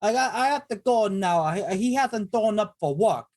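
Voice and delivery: put-on high, hammy Italian accent; thick Italian accent. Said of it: "I'll use a thick Italian accent".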